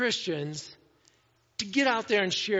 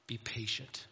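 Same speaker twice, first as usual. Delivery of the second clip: gentle hush; 8 semitones lower, 11 dB quieter than usual. Retold or imitated. Spirit of God speaks softly.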